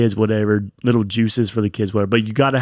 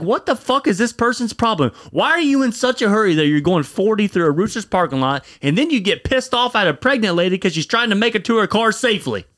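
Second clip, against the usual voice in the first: higher-pitched exasperated retold rant; pissed off; 9 semitones higher than usual. Husband ranting at a driver speeding through the parking lot, pissed off. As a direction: demand answers in a heated, raised pitch. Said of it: "It pissed me off".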